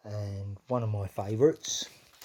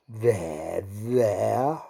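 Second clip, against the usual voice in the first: low, gentle "there, there"; soothing; 3 semitones lower, 4 dB louder than usual. Acted. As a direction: soothe a fretful child. Queen soothes the king.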